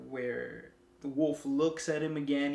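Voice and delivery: ordinary talk, nothing marked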